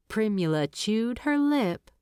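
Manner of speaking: plain reading voice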